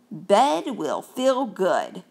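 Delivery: slow, contented mouse voice; sleepy mouse. Acted. Tired mouse looking forward to bed, slow and content. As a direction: savour it slowly.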